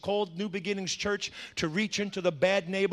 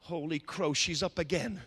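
demon gatekeepers; 4 semitones lower, 6 dB quieter than usual. Acted. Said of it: "This is what they say".

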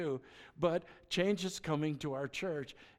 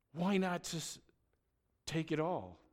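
ordinary delivery

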